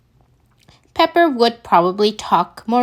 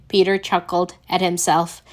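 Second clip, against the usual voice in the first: plain reading voice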